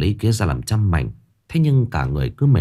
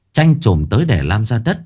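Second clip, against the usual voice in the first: ordinary delivery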